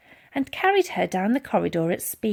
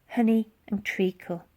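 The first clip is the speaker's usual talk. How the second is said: usual speaking voice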